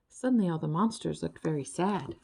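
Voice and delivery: ordinary delivery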